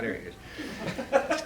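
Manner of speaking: laughing. Real, not acted. Spots Tony in the crowd.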